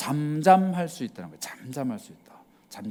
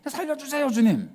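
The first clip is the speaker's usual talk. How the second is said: high, urgent cry to the Lord; desperate plea; 10 semitones higher than usual. Retold or imitated. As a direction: beg for rescue with everything. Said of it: "이거 아니죠".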